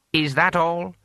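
lightly voiced lord losing patience; impatient lord. A narrator's reading. Lord presses the eunuch.

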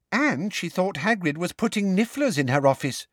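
high-pitched interjection; piping up. A narrator's reading. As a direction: chime in with a high girlish voice. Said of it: "piped up Katie Bell".

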